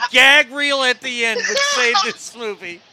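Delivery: laughing loudly through the words; cracking up while pitching the idea. Real, not acted. Host excited by his own idea.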